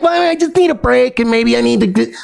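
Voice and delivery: high, sing-song mock excuse; mocking imitation. Quoting the ex asking for a break, mocking. Mocks ex's excuse for leaving.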